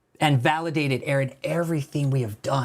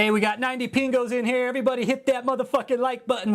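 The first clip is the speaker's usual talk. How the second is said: much higher, fast hype to the viewers; hyped chat call; 10 semitones higher, faster than usual. Real, not acted. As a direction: pump up the stream. Streamer hypes the chat.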